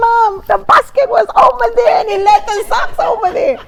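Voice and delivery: high, loud, tearful whining; tearful complaint. Retold or imitated. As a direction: protest to mom like the socks are a tragedy. Wife complaining to mother about husband.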